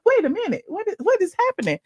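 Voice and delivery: high-pitched bewildered questioning; retold surprise. Daughter waking to unexpected flowers, bewildered surprise.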